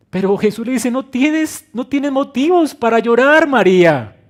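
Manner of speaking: preacher booming Jesus' promise to grieving listeners; full-force preaching. Acted. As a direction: declare "No llores" as a promise. Preacher voices Jesus to María.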